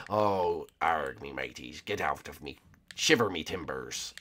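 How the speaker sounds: low, slow pirate growl; British pirate. Shown as a demonstration. Player showing his pirate talk to viewers, British pirate voice. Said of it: "I'm talking like a British pirate".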